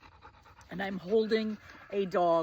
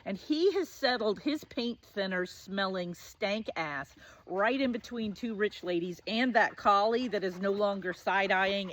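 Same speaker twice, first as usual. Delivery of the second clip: ordinary talk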